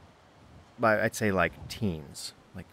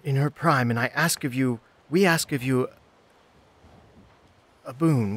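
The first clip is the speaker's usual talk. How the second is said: higher, halting formal plea; pleading young petitioner; 6 semitones higher, 4 dB louder than usual. Acted. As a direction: entreat a water spirit for a boon. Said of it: "the girl turns to the pond".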